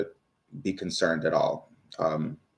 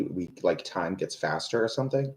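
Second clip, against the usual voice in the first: ordinary delivery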